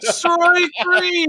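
high, drawn-out umpire yell; showy umpire call. Retold or imitated. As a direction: holler it like an over-the-top umpire. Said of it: "Some umpires are like".

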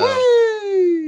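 high, loud exclamation; excited cheer. Real, not acted. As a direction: shout it with delight. Cheering family back together.

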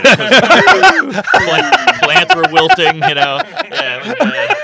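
high, loud laughing talk. Real, not acted. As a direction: laugh the words out. Laughing through own punchline.

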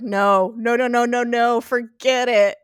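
insistent retold refusal; emphatic refusal. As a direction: shut the idea down hard. Retold flat refusal of suggestion.

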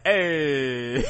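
drawn-out rapper ad-lib; joking impression of a rapper's call. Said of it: "[name] sound like".

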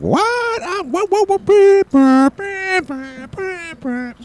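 very high squealing outburst dissolving into beeps; mock outraged wife. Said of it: "all of a sudden she's like".

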